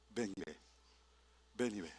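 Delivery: low, soft gentle invite; tender invitation. Acted. Jesus softly inviting disciples to his house. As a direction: draw them in gently as Jesus.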